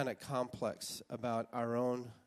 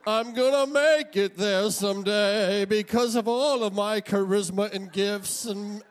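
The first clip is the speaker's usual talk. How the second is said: higher, louder, slow proud declaration; grandiose young dreamer; 7 semitones higher, 6 dB louder, slower than usual. Retold or imitated. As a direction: proclaim your destiny like a cocky teenager. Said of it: "you feel like".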